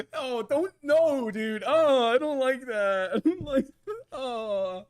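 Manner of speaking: high, loud wail of protest; dismayed outcry. Real, not acted. Streamer protests what was just shown, wailing dismay. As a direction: recoil loudly, dragging out the complaint.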